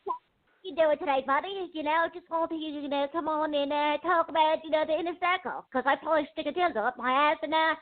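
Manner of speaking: a hint of a mocking caricature; rattlesnake guy impression. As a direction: mimic the streamer's chatter. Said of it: "like that rattlesnake guy".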